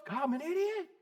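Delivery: a hushed mock admission to God; soft self-rebuke. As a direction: murmur it like a private confession. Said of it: "Y'all ever say that to yourself?".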